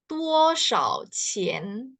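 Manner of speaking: "Duo shao qian?" said very slowly, syllable by syllable; slow Mandarin. Shown as a demonstration. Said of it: "kalian bisa menanyakan". (pelafalan Mandarin pelan)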